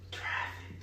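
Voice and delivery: hushed secret whisper. Shown as a demonstration. Speaker hushing the crowd on the key word, whispered.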